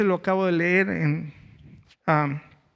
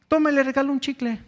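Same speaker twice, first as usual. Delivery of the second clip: put-on higher-pitched generous kid; raised pitch, mildly acted little boy; child gum seller; 6 semitones higher, 4 dB louder than usual. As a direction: lightly voice a young street vendor offering a gift. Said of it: "llega un niño vendiendo chicles".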